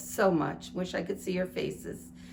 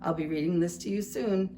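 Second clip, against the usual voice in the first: ordinary delivery, nothing marked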